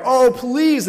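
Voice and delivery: high, hammed-up plea; begging. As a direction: plead like a desperate student. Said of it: "they begged and they begged".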